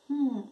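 soft, short thoughtful "hmm"; pensive hum. Acted. Townspeople puzzling over the bird, pensive hum. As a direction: hum softly, turning it over. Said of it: "se quedaron pensando".

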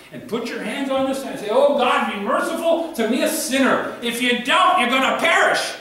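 loud, high, pleading appeal; urgent full-force preaching. Acted. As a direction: call them to repent with urgency. Preacher urging sinners to repent.

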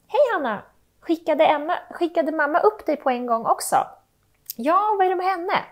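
put-on kid sibling chatting. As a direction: play the younger kid gossiping about mom.

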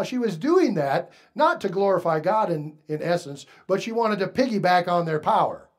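usual speaking voice

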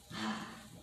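soft breathy sigh of release; releasing sigh. Shown as a demonstration. Meditation leader shows how to release, soft sigh. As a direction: let out a soft, letting-go sigh.

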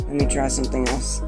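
ordinary delivery

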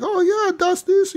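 very high, cartoonish fake German; mock German babble. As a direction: squeak out nonsense German like a caricature. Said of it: "he's like".

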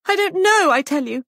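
very high, loud outburst; distressed cry. A narrator's reading. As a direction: cry out under pressure. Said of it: "cried Molly".

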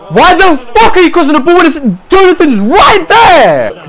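high shout, slowing at the end; loud angry disbelief; exasperated yelling. Real, not acted. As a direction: berate the player for ignoring a teammate. Berating a bad cross.

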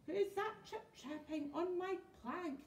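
high, mildly mocking question; jeering. A narrator's reading. Pirate chief mocking the troll on the plank, jeering. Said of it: "jeered Hank Chief".

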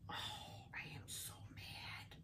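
whispers it to herself, barely voiced; whispered. Real, not acted. Viewers hear her whisper her chipped-piece frustration. Repeats it under her breath.

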